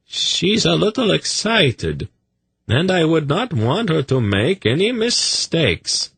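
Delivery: high-pitched, careful explanation; apologetic. A narrator's reading. Zadi excusing his pet to Agachak, apologetic. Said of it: "he apologized".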